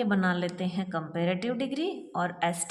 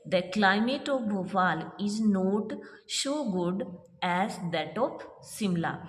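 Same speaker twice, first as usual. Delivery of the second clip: slow dictation; much slower than usual. Shown as a demonstration. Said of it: "यानी कि भोपाल की जो climate".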